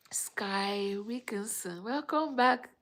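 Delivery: loud, high, slow greeting call; excited greeting. Real, not acted. Vlogger calling out a loud welcome to viewer Sky.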